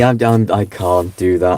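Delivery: normal talking voice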